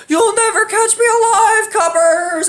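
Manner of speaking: high, drawn-out cartoon getaway cry; mock outlaw taunt. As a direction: imitate a playful robber taunting the police. Friend acting fleeing robber, retold.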